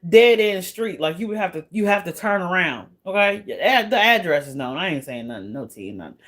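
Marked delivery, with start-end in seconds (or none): 4.8-6.2 much softer, low muttered aside; muttering. Real, not acted. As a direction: mumble it under your breath.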